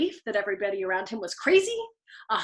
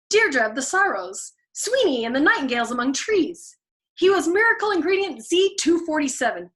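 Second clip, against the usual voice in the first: grandiose build; 6 semitones higher, 5 dB louder than usual. A narrator's reading. Mounting grandiose list.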